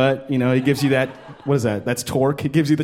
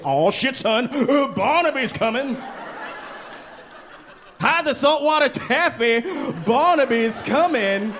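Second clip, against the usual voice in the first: higher, hammed-up alarm; a higher mock-frightened townsman; mock panic; 9 semitones higher than usual. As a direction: warn the neighbors in fake terror. Scared townsfolk quote.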